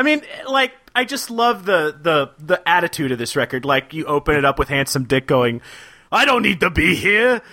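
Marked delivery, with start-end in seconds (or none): 6.1-7.4 wrestling promo. Retold or imitated. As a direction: bellow it like a pro wrestler. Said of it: "he's imitating the Crusher".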